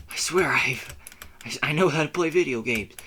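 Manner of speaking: normal talking voice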